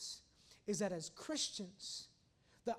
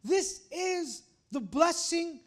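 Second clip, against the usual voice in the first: raised voice in slow, weighted phrases; emphatic preaching; 6 semitones higher, 10 dB louder, slower than usual. Real, not acted. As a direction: drive each point home to the congregation. Preaches the main point.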